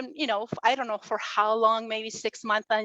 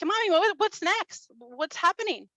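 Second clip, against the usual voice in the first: put-on much higher, eager child's voice asking what comes next; imitating her little girl; 7 semitones higher than usual. Little daughter begging mom for the rest of the story, high eager child voice. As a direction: play the small daughter, high and curious. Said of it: "and the girl's like".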